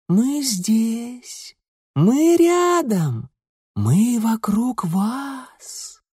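high, very slow ghostly calling; echoing ethereal energy being; otherworldly voice. A narrator's reading. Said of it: "тихий потусторонний голос". (потусторонний голос)

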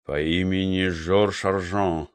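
low, soft, slow musing; thoughtful drawl. A narrator's reading. Investigator echoes the chemist's words to himself, thoughtful drawl. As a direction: draw the words out pensively. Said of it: "задумчиво протянул Петрусенко".

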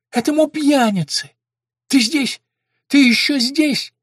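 high, loud, frantic mother; loud, high-pitched distraught questioning; agitated outburst. A narrator's reading. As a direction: cry out in agitation. Mad mother rants at her son.

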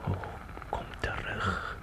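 slow breathy whisper; longing whisper, drawn out; whispered plea. Acted. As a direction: whisper it slowly as if calling a ghost. Pleading to vanished past.

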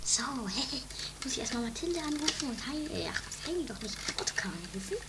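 hushed, secretive muttering to herself; softly scheming aside; quiet aside. Acted. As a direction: keep it low so nobody hears. Sneaking off to phone secretly.

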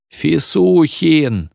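whole building groaning the name; moaning call. A narrator's reading. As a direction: moan the name out long. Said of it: "стонало здание".

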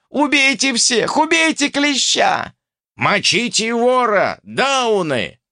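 high, drawn-out furious cries; battle shouting. A narrator's reading. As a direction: yell orders over a brawl, high. Crowd yelling to kill. (яростные крики в драке)

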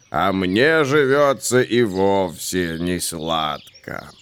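low, very slow sighing voice; a weary tree groaning out a complaint; sighing old tree. A narrator's reading. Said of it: "вздохнуло так".

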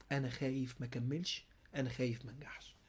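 low, hushed, almost whispered worry; timid mock excuse. Inner self pleading fear of failing, timid and hushed. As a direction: breathe out the excuse barely aloud. Self's excuse repeated softly.

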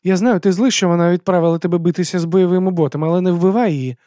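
high-voiced woman pleading urgently; high, loud earnest plea; slightly calmer pleading. A narrator's reading. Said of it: "додала спокійнішим голосом".

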